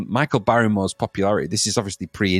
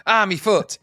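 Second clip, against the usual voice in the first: higher pretend yelp of hurt; mock pain; 8 semitones higher, slower than usual. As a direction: cry out as if injured mid-dance. Acting out dance injury.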